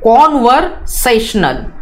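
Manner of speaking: कन्वर्सेशनल spoken slowly and clearly; slow teacher's model of कन्वर्सेशनल; model pronunciation. Shown as a demonstration. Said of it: "इसका pronunciation कैसे किया जाता है".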